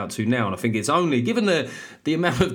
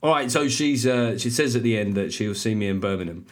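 ordinary talk, nothing marked

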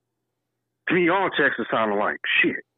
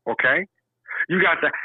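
ordinary delivery

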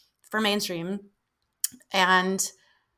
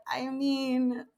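softer, noncommittal hedge; imitated reluctance; 4 semitones higher, 6 dB quieter, slower than usual. Sound guy avoiding an honest answer, imitated reluctance. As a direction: hedge awkwardly, as a retold reply.

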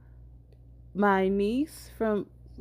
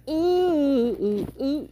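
excited oohs; 7 semitones higher, 4 dB louder, much slower than usual. Real, not acted. Excited about the guest.

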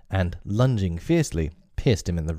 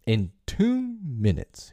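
higher, slow, dramatic warning; cried warning; 7 semitones higher, slower than usual. Acted. Hook warns the crew before the blast, crying out slow and high. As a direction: announce the doom to all aboard. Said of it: "he cried".

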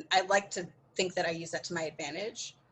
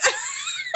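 sudden high-pitched laughing squeal; high squeal of laughter; 5 dB louder than usual. Real, not acted. Recalling the meeting accusation, she squeals with laughter. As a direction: let out a high, helpless laugh.

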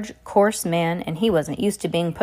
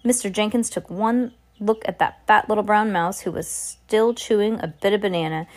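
ordinary delivery, nothing marked

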